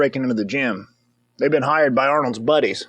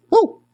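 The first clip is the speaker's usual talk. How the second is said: cheer; 4 dB louder than usual. Real, not acted. Cheering on the dancer.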